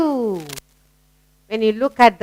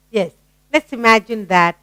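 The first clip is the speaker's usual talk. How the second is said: usual speaking voice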